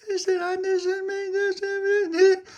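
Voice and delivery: put-on crying girls telling the puppy what happened. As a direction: wail the name like upset little girls. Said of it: "they were both crying".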